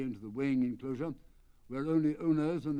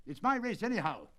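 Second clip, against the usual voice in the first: a light breathless gasp, a bit higher; 6 semitones higher than usual. Acted. The colonel gasping with relief as his horse wins. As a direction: push it out on a short breath. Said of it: "gasped the colonel".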